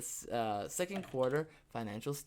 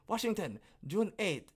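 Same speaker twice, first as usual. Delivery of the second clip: put-on poorly done African accent, pitched up; attempted African accent; 6 semitones higher than usual. Host starting a news story, botched African accent. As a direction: have a failed go at an African accent. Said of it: "I was gonna do an African accent".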